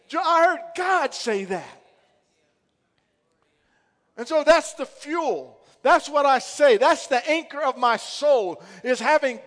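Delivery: high, loud, driving sermon; preacher rallying the church; preaching at full force. Real, not acted. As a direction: preach it with fire.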